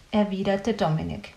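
ordinary delivery

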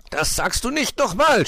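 very high, outraged shriek; furious yell. A narrator's reading. Insulted player about to lunge at rival, yelling. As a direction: yell it as a loud warning. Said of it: "schrie sie".

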